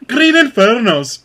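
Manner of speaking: slow, loud, laughing. Acted. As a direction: draw it out as a joke. Joking plural of the title.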